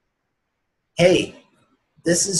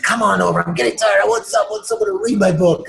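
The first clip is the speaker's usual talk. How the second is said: a louder, higher call to come over; 5 semitones higher, 5 dB louder than usual. Retold or imitated. Author at a book signing calling a mother and son over, in a loud playful call. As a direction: reel them in with a playful shout.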